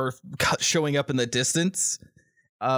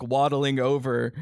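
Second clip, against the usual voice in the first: ordinary talk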